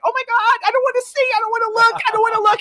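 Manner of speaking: high, flustered "I don't wanna look"; squeamish protest. Retold or imitated. Assistant shielding her eyes from boss posing, high and flustered. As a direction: beg off, half laughing and cringing. Embarrassed, looking away.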